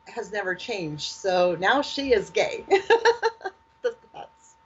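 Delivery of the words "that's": high breathless giggle; nervous laugh. Real, not acted. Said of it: "I'm doing my nervous laugh".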